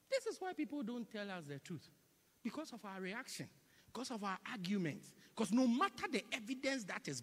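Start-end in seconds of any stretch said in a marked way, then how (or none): none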